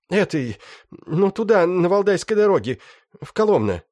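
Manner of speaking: high-pitched rough bandit; young thug voice. Acted. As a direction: whine it like a wounded gangster. Раненый бандит. (высокий голос молодого бандита)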